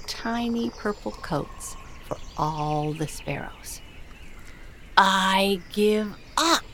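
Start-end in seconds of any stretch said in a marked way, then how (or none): 5.0-6.6 loud, slow exasperated shout. Acted. King finding the woman sewing, giving up with a slow shout. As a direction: throw up your hands and yell it slowly. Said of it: "the king shouted".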